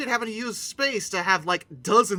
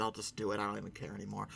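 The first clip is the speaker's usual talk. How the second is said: much softer, lower resigned aside; muttered aside; 11 semitones lower, 15 dB quieter, much faster than usual. Real, not acted. Shrugging off doing a proper review, soft low mutter. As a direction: drop it under your breath, giving up.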